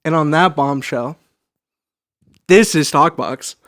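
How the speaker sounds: slow, dramatic show outro; announcer sign-off. Acted. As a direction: announce the show name slowly.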